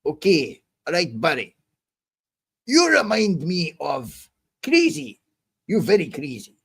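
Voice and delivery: put-on Russian accent, teasing; mock Russian accent, drawn-out words. Shown as a demonstration. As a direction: let the Russian come out. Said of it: "You want the Russian to come out?".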